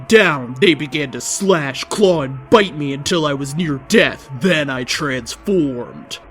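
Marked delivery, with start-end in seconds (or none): none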